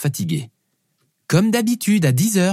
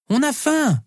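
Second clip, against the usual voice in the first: put-on high, insistent child's voice; little girl complaining; 13 semitones higher, slower than usual. Hungry daughter to her dad, high child voice.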